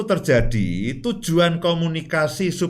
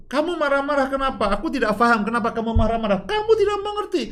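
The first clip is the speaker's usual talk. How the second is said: arguing spouse; 8 semitones higher, much faster than usual. Acted. Acted quarrel between spouses.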